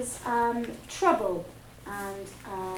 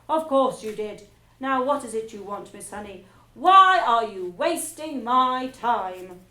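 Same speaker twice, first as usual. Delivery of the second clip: impatient scolding; 11 dB louder than usual. Acted. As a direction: lean on every word, fed up. Trunchbull loses patience.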